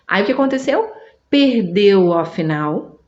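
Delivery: normal talking voice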